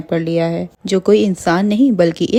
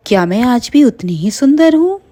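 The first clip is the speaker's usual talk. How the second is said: high, self-admiring witch; delighted wonder; 6 semitones higher, 3 dB louder than usual. Acted. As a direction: marvel at yourself, pitched high. Vain witch marvels at her beauty.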